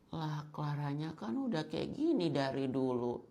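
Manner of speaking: put-on soft-spoken pick-me girl defending herself. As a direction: coo it gently, acting delicate. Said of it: "Soft spoken banget".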